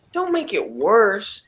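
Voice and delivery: normal talking voice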